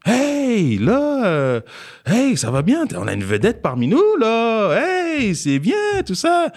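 high, bright, over-eager welcome; cheery principal. Retold or imitated. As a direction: gush like a fake-friendly principal. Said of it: "imite le directeur enjoué". (directeur faussement enjoué, voix très aiguë)

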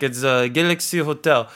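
put-on French-accented hotel manager; name the hotel in a French accent. Hotel manager naming the isolation hotel, French accent. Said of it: "some French guy".

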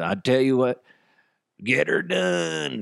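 rough impression of a comic character; Larry the Cable Guy. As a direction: stretch out the comedian's catchphrase. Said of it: "He does his voice".

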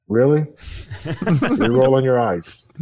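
lightly hammed-up exasperation; mock disbelief. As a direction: retell a dad's exasperated comeback, half-amused. Kids rolling eyes at dad.

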